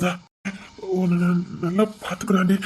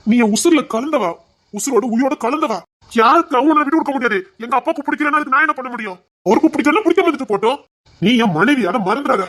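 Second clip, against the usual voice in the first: passionate outburst; 4 semitones higher than usual. Acted. Husband passionately reassuring wife.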